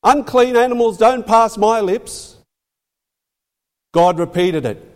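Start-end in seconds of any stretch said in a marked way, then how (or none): 0.0-2.4 indignant refusal. Retold or imitated. Peter refusing God, acted quote.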